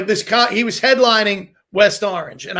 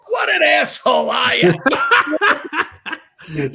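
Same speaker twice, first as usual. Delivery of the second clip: slow, high, laughing through the words; laughing at himself; 6 semitones higher, much slower than usual. Retold or imitated. Headliner laughing as he admits his own diva move. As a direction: crack up while owning the mistake. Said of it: "and he starts laughing".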